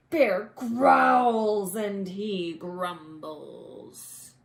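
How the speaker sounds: loud rumbling, slowed reading; growling loud narration. A narrator's reading. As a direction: boom out the bear's rage. Angry bear awake.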